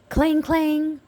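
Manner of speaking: high ringing clang clang; bell clang imitation. A narrator's reading. Little B ringing the fire engine bell, high ringing clang. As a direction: ring it out like a bell.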